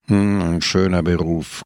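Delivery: low, grumbling disapproval; knurrend. A narrator's reading. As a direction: growl it out dryly. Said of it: "knurrte Don Camillo". (mürrisch knurrend)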